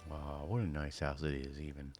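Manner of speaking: normal talking voice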